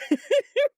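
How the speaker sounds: sudden high laugh. Real, not acted. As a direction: burst out laughing. Laughing about her caddy.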